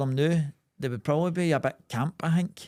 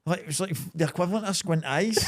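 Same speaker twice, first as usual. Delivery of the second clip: normal talking voice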